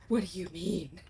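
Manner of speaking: whispered question. Real, not acted. Player reacting to the whispered line, whispering back. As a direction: breathe the question.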